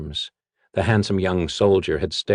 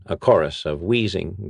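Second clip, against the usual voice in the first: ordinary delivery, nothing marked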